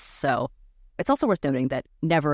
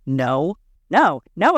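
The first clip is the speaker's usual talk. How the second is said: loud refusal; 6 dB louder, much slower than usual. Retold or imitated. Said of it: "And I was like".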